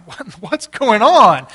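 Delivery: acted-out startled confusion; alarmed question. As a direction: demand to know what's happening. Acting his startled response to shriek.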